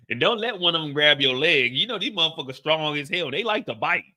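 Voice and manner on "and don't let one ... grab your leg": ordinary delivery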